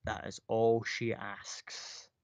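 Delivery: very slow, distant dragon pronouncement. Acted. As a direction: let the dragon speak with unhurried weight. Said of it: "the dragon going".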